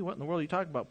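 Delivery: usual speaking voice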